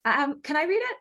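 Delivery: high squeaky chipmunk pitch. Real, not acted. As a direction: voice jumps way up high. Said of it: "this little chipmunk voice that just jumped out of me".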